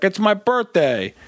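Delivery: milk it for free cake; fake-sweet birthday claim. Grandfather scamming a restaurant, wheedling slowly. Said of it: "be like".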